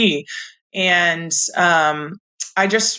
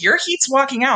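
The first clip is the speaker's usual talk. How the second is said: urgent warning; 9 semitones higher than usual. Retold or imitated. Officials hurrying her.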